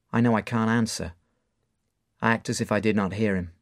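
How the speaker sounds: ordinary delivery, nothing marked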